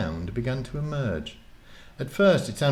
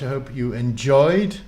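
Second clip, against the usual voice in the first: plain reading voice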